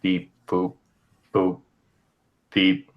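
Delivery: low, beeping robot sounds. Pretend. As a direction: beep it out like a robot. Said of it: "I could just be a robot".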